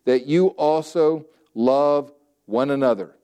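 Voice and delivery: very slow, word-by-word scripture quote; quoting scripture. Retold or imitated. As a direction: stretch each word out for weight. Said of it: "and he says".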